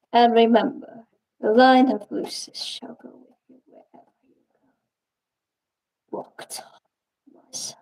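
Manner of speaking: hushed regal blessing, breathy and unvoiced; solemn king's whisper. Puppeteer voicing king Regis puppet, a solemn whispered farewell. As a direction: whisper it like a dying king sending off his son. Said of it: "based off of a video game character named Regis".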